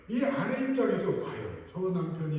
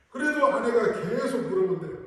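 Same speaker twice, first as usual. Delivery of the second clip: ordinary talk, nothing marked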